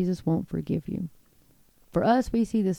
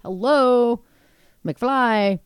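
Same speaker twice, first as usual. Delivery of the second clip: mocking wake-up call; 3 semitones higher, 5 dB louder, much slower than usual. Mocking "hello McFly" jab.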